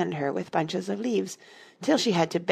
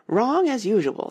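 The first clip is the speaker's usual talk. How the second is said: high, loud put-down; a haughty queen correcting a child; scolding queen; 6 semitones higher, 5 dB louder than usual. A narrator's reading. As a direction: snap out the verdict. Red Queen scolds Alice.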